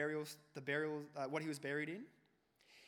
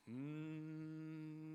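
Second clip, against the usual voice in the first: hum; 4 dB quieter than usual. Retold or imitated. Class humming to annoy teacher.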